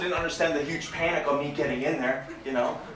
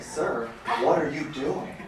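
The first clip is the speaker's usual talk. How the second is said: ordinary talk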